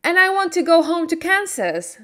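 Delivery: put-on eager little girl pleading; girl voice, high and quick. Dorothy voiced high, fast.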